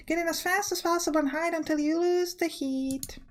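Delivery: high-pitched attempt at a Spanish accent; Spanish-accented gardener. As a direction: offer help in a mock Spanish accent. Voices Maria, the Spanish gardener.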